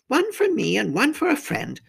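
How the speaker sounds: high-pitched faun character voice; hospitable faun. A narrator's reading. Faun showing the girl his chairs, high and hospitable.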